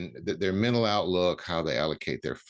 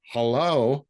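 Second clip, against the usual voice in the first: drawn-out, loud, higher "like, hello"; sarcastic hello; 6 semitones higher, 5 dB louder than usual. Real, not acted. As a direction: say it as if it's obvious. Mocking the scarcity claim.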